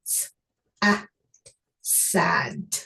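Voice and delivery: S-, a, sad with each sound apart, then blended. Shown as a demonstration. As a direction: segment the sounds again slowly, then join them. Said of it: "Let me sound it out for you".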